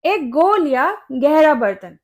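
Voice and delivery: ordinary talk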